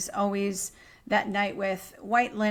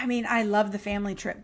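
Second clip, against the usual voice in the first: ordinary talk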